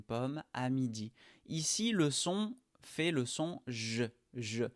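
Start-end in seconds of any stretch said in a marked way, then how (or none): none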